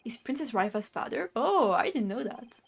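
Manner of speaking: usual speaking voice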